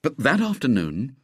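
ordinary delivery

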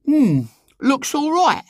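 put-on very high, slow girl's appraisal; lofty. A narrator's reading. Eglantine surveying the garden, lofty. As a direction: pass grand judgement, drawing it out. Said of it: "commented Eglantine loftily".

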